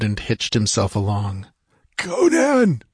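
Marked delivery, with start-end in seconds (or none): 2.0-2.8 high, choked cry of a name; choking cry. Acted. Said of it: "he cried chokingly".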